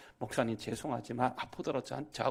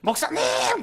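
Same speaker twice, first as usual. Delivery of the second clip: loud, very high cry down the phone; acted high-pitched shout; excited caller; 10 dB louder, slower than usual. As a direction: imitate an overjoyed caller shouting for the pastor. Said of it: "전화가 또 걸려온 거예요".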